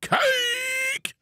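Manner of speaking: high, stretched game-show shout of the name; announcer intro. Acted. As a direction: call the guest in like a showman. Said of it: "was that the intro you expected".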